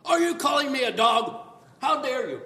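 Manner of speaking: loud, high, hammed-up offense; a pretend insulted woman snapping back at Jesus, loud; mock insulted. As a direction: play outraged at being called a dog.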